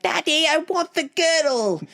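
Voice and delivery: a bratty princess begging her father; spoiled daughter. Retold or imitated. King's daughter begging her father for the girdle, whiny high voice. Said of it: "Eurystheus' daughter that was like".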